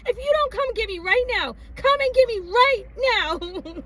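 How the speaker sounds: high insistent plea, slowing at the end; firm begging to be picked up; demanding young self; urgent demand. Retold or imitated. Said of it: "And I said".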